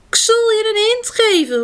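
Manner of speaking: high piercing shrill voice. A narrator's reading. Girafant offers a hint in a shrill voice. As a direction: call it out shrill. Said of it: "met schelle stem".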